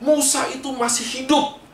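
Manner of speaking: high, startled gasp of recognition; the people below suddenly spot Moses alive; surprised crowd. Acted. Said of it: "Yang dari bawah melihat ke atas".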